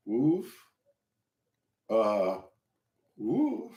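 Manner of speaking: soft, feeble effort grunts; straining to lift. Retold or imitated. Beginner trying to lift cinder-block bar, weakly straining. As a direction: mime failing to budge a heavy bar, with small huffs. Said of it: "As soon as I tried to bend down and grab that".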